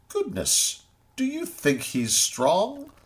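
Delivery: high, scolding kangaroo voice, lightly voiced; a bold kangaroo mocking the crowd; kangaroo shouting reproach. A narrator's reading. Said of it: "loud he shouted with reproach".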